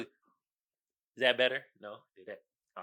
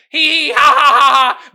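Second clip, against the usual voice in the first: loud, high imitated giggle; mocking laughter; 4 semitones higher, 11 dB louder than usual. Classmates laughing at the fallen student, mock cackle.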